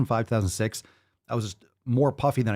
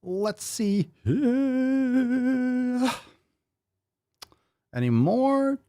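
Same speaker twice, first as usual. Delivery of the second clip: drawn-out silly voice; 12 semitones higher, much slower than usual. Pretend. Reading comments in drawn-out voice.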